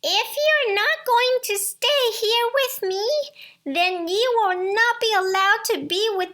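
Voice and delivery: high, loud hissing witch threat; furious witch. Acted. Furious enchantress threatening Rapunzel, high hissing rage.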